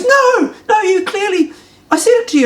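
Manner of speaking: put-on lampoon the girlfriend as shrill and indignant; cartoonish high-pitched girlfriend demanding answers; girlfriend's angry question. Girlfriend voiced in heated quarrel.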